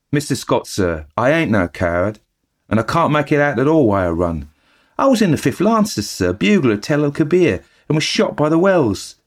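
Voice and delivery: chatty eager bellboy reporting the news; Cockney bellboy. Acted. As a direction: Thomas the bellboy gossiping to his master. Thomas defends himself.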